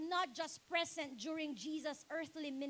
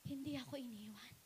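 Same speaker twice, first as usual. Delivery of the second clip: soft, quick whisper, much lower; tender whisper; 5 semitones lower, 7 dB quieter, faster than usual. Acted. The Lord speaking of the woman who stayed, whispered. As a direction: breathe it out gently.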